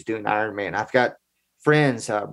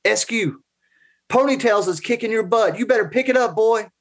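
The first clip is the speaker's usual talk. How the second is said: drill sergeant bark; 8 semitones higher than usual. Retold or imitated. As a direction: bark it like a Marine drill instructor. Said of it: "former Marine drill sergeant".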